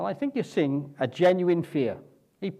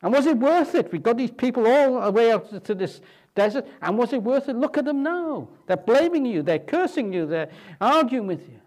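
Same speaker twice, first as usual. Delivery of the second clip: higher, louder complaint of a worn-out Moses; exasperated Moses; 7 semitones higher, 4 dB louder than usual. Retold or imitated. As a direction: Moses venting to the Lord about his people. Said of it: "he's almost saying".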